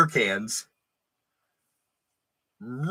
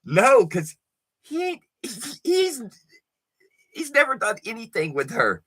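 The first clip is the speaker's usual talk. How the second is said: higher, giggly nervous excuse; goofy friend; 9 semitones higher than usual. Retold or imitated. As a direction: play it off like a grinning buddy. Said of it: "and he's like".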